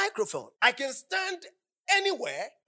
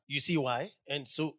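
ordinary talk

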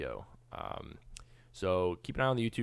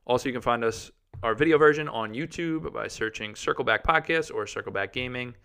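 announcer read; 4 semitones higher, 7 dB louder than usual. Acted. Outro promo read.

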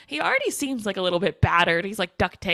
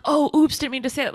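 high, louder fake "oops"; mock accidental slip; 5 semitones higher, 3 dB louder, faster than usual. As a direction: pretend you let it slip by mistake. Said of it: "it wasn't like".

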